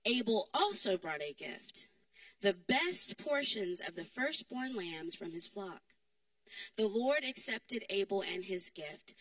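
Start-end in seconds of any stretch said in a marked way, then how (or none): none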